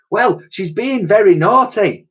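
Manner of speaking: huffy and high; indignant. Acted. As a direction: protest like a cross child. Dotty indignantly defends her scolding.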